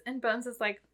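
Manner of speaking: ordinary talk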